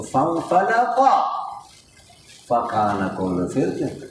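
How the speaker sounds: F said as P in فَانْفَلَقَ and فِرْقٍ; Pashtun-style pronunciation. Retold or imitated. Teacher imitating Pashtun reciters, F turned to P in فَانْفَلَقَ. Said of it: "بعض پٹھان لوگ فا کو کیا پڑھتے ہیں".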